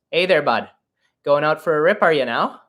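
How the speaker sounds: Canadian hockey player. Shown as a demonstration. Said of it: "how Canadians like to speak".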